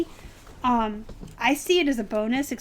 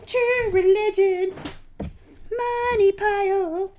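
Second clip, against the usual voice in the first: slow, high, loud gleeful announcement; excited; 8 semitones higher, 5 dB louder, slower than usual. Real, not acted. Reseller gleefully announcing her best find, high and loud. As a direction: crow over the prize like a jackpot. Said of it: "by my excitement".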